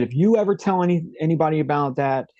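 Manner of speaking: ordinary delivery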